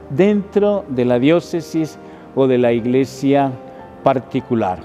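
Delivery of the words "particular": usual speaking voice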